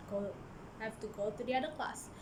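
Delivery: normal talking voice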